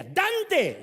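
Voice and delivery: loud, high, sharp call of the name; angry mother calling. Retold or imitated. Mother yells for her son an hour late to dinner, loud and high. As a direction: yell for the late kid. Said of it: "Si decía".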